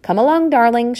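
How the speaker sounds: high, loud rallying call; encouraging cry. A narrator's reading. Mother urges tired children on, crying out. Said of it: "she cried".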